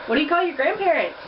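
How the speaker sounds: usual speaking voice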